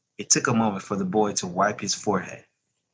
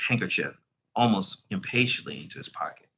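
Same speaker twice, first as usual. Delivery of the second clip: ordinary delivery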